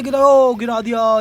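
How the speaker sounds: high, loud burst of excitement; sudden high and loud whoop; excited shout. Real, not acted. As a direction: cheer as the toy topples. Cheering the toy's fall.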